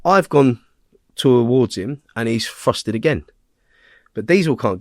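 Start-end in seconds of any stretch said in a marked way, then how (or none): none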